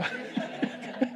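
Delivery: a soft unvoiced laugh after the punchline; breathy chuckle. Real, not acted. Preacher chuckling after the astronaut joke to the congregation. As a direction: chuckle quietly.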